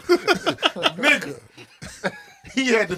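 laughing. Real, not acted. Laughing at teen gunman.

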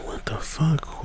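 frightened hushed whisper, pitch up; scared whisper. Retold or imitated. Campers whisper to each other in fear after the knocking. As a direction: whisper it in alarm, afraid to be heard.

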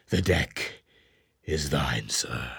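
soft, low near-whisper; hushed handover. Acted. Ahab gives Starbuck the deck, hushed. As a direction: hand over the watch under your breath.

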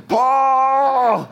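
hammed-up cry of rage in the night; mock anguished shout. Leaders waking at night shouting Paul's name, hammed up.

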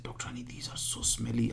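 hushed whisper, half to himself; retold whisper. Whispering his complaint to the doctor about the smell.

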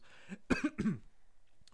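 harsh throat clearing after swallowing wrong; choking on a drink. Real, not acted. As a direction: clear the throat hard, coughing out a sip. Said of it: "da habe ich mich kurz verschluckt".

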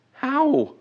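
high-pitched, disbelieving question; acted-out bafflement; incredulous. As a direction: voice jumps up in mock disbelief. Imagined Israelites in disbelief.

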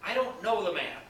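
high, loud Peter swearing he is not one of them; Peter's emphatic sworn denial. Acted. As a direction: insist under oath, pushing the voice up. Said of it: "he denied it with an oath".

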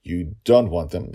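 plain reading voice

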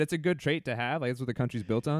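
ordinary talk, nothing marked

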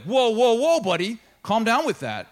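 hammed-up indignant brush-off; mock offended protest. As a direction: push back like a touchy churchgoer. Mock church member rebuffing accusation.

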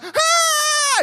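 very high, loud shriek; imitated little girl's scream. Upset little girl in mom's arms, imitated scream. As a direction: shriek like an upset small child. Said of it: "She's, like, screaming".

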